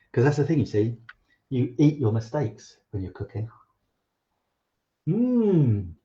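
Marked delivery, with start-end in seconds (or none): 5.1-5.9 high pleased hum of enjoyment; savoring mm. Real, not acted. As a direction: hum as the chocolate hits. Tasting chocolate.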